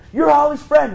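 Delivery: loud, high-pitched retold exclamation; over-eager, high and loud; excited greeting. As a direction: burst out at a stranger, thrilled to recognize them. Retelling running up to stranger's window.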